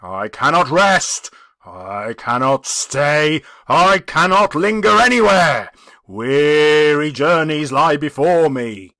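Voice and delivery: a restless soul mourning its fate; anguished ghost. Acted. The ghost speaks.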